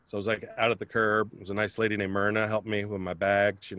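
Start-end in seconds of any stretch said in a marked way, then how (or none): none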